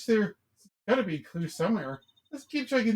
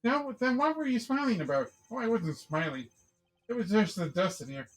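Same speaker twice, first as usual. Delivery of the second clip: ordinary talk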